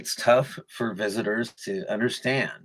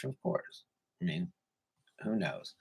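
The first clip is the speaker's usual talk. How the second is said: whispered aside; 5 semitones lower, 13 dB quieter than usual. Real, not acted. Throwaway aside after a joke.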